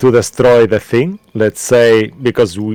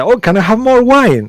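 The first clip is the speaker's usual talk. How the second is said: high, loud tipsy plea; tipsy bride-to-be asking for more wine; tipsy woman; 11 semitones higher, 6 dB louder than usual. Acted. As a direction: ask for wine like a drunk woman. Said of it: "she's quite tipsy".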